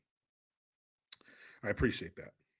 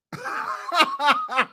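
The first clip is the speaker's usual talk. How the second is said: high burst of laughter; shrieking laugh. Real, not acted. Big laugh.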